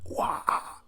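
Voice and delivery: loud, hammed-up wail of fright; big comic scream of panic; mock scared yell. A narrator's reading. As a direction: holler like a warrior suddenly terrified. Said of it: "Colin's yelling out too".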